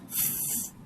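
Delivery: f said unvoiced, just a hiss of air; unvoiced f sound. Shown as a demonstration. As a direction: breathe out an f. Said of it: "the f sound".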